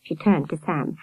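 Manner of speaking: usual speaking voice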